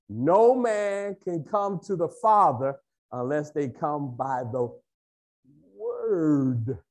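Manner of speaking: stretched out, pausing before "Word"; slow, emphatic. Real, not acted. Preacher teaching that the Word is the way, slow and weighty. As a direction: hold back "Word", then draw it out.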